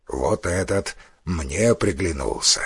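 low, soft, deliberate voice; cannibal elder. A narrator's reading. The elder picks Кана to eat, low and menacing. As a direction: pronounce it low and quiet, slowing at the end.

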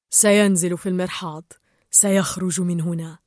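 happy Gill. A narrator's reading. Gill tells the tank of the escape, low understated happiness. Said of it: "قال جيل في سعادة".

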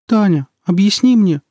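a frightened mother peeps out her plea; mother squeaks plaintively to her daughter. A narrator's reading. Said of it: "жалобно пискнула мама".

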